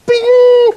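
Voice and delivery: very high, loud sung ding; ping it out like a microwave bell; imitated ding. A mock microwave bell for the cooking demo.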